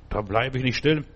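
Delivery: normal talking voice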